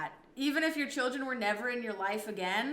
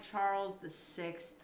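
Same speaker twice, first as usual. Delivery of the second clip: ordinary talk, nothing marked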